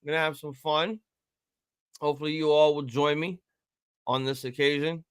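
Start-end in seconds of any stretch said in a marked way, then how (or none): none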